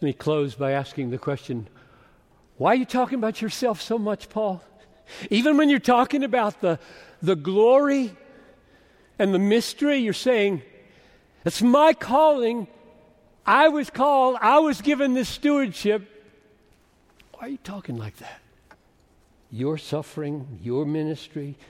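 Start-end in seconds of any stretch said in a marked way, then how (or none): 2.6-16.0 loud challenging question. Acted. Preacher questions Paul, voices his reply. 17.3-18.4 quiet aside. Real, not acted. Quiet pointed question.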